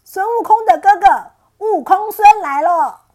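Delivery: loud, high taunting shout; shouting outside the cave. Acted. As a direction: bellow it up at the cave mouth. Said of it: "又到山洞外大喊".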